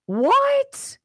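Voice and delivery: very high, loud disbelief; stunned what. Real, not acted. Host stunned by the guest's figure, high and loud. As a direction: shoot the word up in shock. Reacts to 1.3 million.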